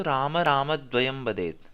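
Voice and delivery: राम राम with a clear push on the closing म, slow; stress on final म. Shown as a demonstration. Teacher models राम राम द्वयम वदेत for the student, stressing म. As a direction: land on the म at the end of each राम. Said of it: "अंत में म पे जोर दिया".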